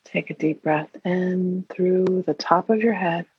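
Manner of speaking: normal talking voice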